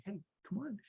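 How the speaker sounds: hushed, gentle plea; kind coaxing. Retold or imitated. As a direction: coax a child gently and quietly. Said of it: "I would be kind".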